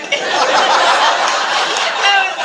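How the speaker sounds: loud, high laugh; burst of laughter. Real, not acted. Guest cracking up at the story, loud high laugh.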